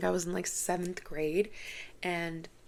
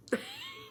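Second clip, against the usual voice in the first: sudden high laugh; 5 dB quieter than usual. Real, not acted. Laughing after correcting herself.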